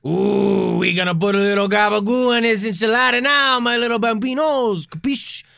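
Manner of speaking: put-on hammy Italian mafia accent; Italian mobster accent. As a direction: play a cartoon Italian mobster. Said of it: "very Italian".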